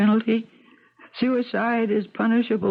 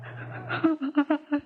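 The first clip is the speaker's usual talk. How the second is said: bitter laugh; 6 semitones higher, 7 dB quieter than usual. Acted. Bitter laugh after remark.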